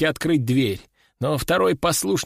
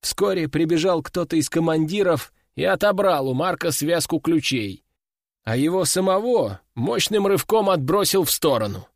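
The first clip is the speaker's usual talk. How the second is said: usual speaking voice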